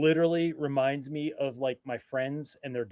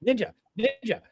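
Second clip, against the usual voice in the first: high, quick mock ninja cries with a louder curse; mimicked ninja calls; 7 semitones higher, 4 dB louder than usual. Friend throwing his blade at the magnetic wall, mock excited calls. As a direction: call it out high, like a repeated throw going wrong.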